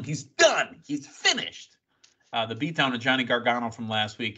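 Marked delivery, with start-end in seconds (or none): 0.0-1.8 mock yell, pitch leaping up at the end; half-strength high-pitched retold yell; imitated yelling. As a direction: imitate a worked-up wrestler yelling, held back in volume. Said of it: "Grayson Waller yelling".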